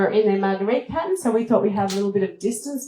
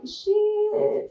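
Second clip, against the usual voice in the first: startled curse; 12 semitones higher than usual. Retold or imitated. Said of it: "I'm like".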